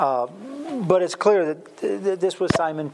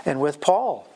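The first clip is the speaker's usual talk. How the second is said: ordinary talk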